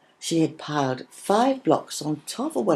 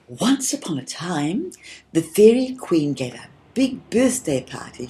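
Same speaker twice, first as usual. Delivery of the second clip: happy; 3 semitones higher, 4 dB louder than usual. Acted. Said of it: "she said happily".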